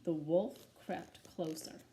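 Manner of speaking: usual speaking voice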